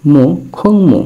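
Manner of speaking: খং মু lifted high and sounded clearly; model Korean pronunciation. Shown as a demonstration. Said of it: "এর সাথে দিলাম উ".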